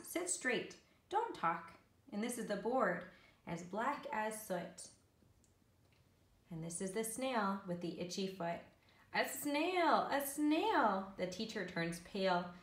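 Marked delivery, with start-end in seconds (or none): 0.1-1.7 high-pitched, brisk classroom command; lightly voiced strict schoolteacher; teacher's orders. A narrator's reading. Teacher tells her class to behave, ordering. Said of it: "telling her class". 9.1-11.1 high, loud storybook cry of discovery; excited exclamation. A narrator's reading. Children spot a snail in class, exclaiming.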